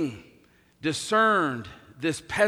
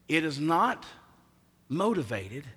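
normal talking voice

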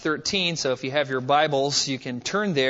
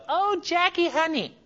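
put-on Southern accent; 12 semitones higher than usual. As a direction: coo it in a syrupy Southern accent like a doting neighbor. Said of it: "she had this incredible Southern accent".

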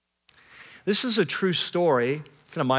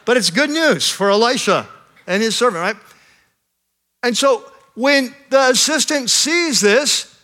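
louder, rising sermon build to a climax; preaching at full force; 7 semitones higher, 6 dB louder than usual. Acted. Pastor to his congregation, preaching at full force. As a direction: preach it, lifting the room.

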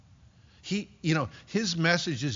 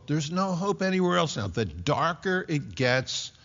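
ordinary delivery

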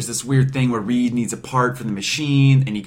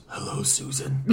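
whispered, unvoiced and soft; creepy whisper; 11 dB quieter than usual. Victor ignores Reed, whispering to Sue. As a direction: breathe it like a creepy villain. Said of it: "Victor just ignores him and goes".

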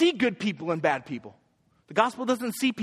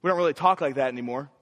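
ordinary talk, nothing marked

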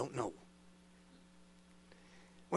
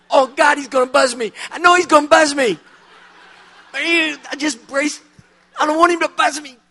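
high, loud, mock-panicked whine of a man bracing for a shock; hammed-up terror, cringing away from the buzzer; panicked dread; 9 semitones higher, 10 dB louder than usual. Fearing the evangelist's hidden buzzer.

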